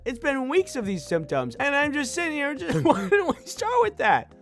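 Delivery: high, exasperated pleading; frustrated, pushing back at the doctor. Retold or imitated.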